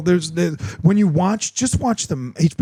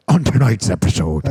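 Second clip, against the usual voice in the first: dramatic trailer voiceover; deep movie trailer narrator; trailer announcer voice; 7 semitones lower than usual. Retold or imitated. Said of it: "if you give me the devil voice".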